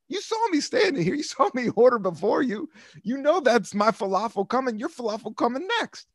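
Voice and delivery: mock indignant. To the lady at the falafel counter, mock indignant. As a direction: lay out the case, hammed-up outrage. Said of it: "I was like".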